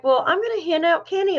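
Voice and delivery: high, quick, eager retelling; bubbly quoting of oneself; retold excitement. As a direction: recount being all excited. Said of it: "I was so excited".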